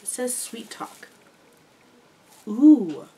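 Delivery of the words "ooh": loud delighted ooh. Real, not acted. Smelling the bath bomb.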